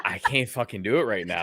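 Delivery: ordinary talk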